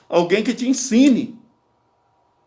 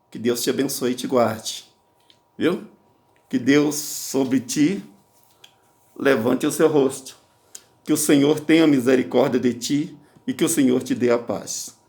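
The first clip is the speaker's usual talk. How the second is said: blessing; 5 semitones lower than usual. Acted. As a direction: bless them gently and solemnly. Pronounces blessing.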